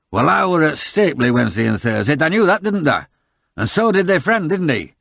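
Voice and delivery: enraged farmer. A narrator's reading. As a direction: rage at the stranger in country speech. Enraged Grimethorpe rants.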